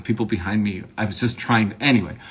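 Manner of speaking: usual speaking voice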